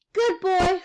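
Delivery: put-on high toddler voice; little boy voice. A narrator's reading. Little boy echoing his mother, high and childlike. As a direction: echo it as a small child.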